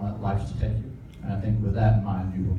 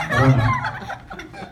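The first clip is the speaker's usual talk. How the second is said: much higher, very slow, drawn out with a laugh; squeaky comic repeat; much slower than usual. Shown as a demonstration. Best man mocking the groom, high laughing squeak.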